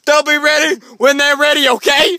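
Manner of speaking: high, loud sassy brush-off; attitude. Retold or imitated. Cashier brushing off the kids, high sassy voice. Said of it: "this lady had so much attitude".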